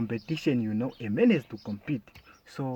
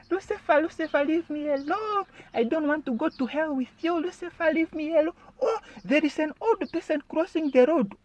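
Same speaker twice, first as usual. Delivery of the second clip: very high, squealing terrified driver; hammed-up falsetto fright; mock panic. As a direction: plead hysterically as if chased by the devil. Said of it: "running away from the devil".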